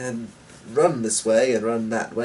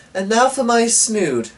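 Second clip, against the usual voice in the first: put-on higher, brighter girl's voice; girlish voice; 11 semitones higher, 5 dB louder than usual. A narrator's reading. Daughter dressing up for the ride, in a light girlish voice. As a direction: play the young daughter lightly. Eleanor's voice, restarted line.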